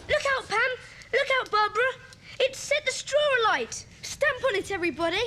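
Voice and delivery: high-pitched, panicked calling out; urgent warning shout. Acted. Child warning friends the straw is alight, urgent shout. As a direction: cry out to warn everyone at once.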